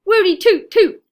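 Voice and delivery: high, loud joyful whoops; cheering. A narrator's reading. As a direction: cheer for the hero. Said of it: "they cried".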